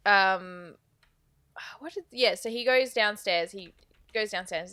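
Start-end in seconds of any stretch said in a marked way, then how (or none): none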